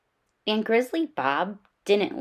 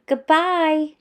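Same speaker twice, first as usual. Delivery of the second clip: high, louder called-out goodbye; shouted goodbye; 9 semitones higher, 5 dB louder than usual. A narrator's reading. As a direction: call it out to the teacher. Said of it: "Brother Bear and Sister Bear shouted".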